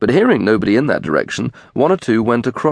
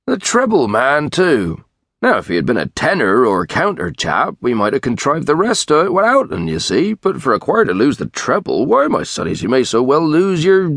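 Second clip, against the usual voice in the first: rustic tranter, lively and higher in pitch; country choirman working up his complaint; exasperated tranter; 5 semitones higher than usual. Acted. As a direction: fret aloud, breaking off at the end. Tranter exclaims over lost singer.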